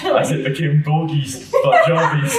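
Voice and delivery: can't get it out for laughing. Real, not acted. Telling the train story to a friend, laughing hard.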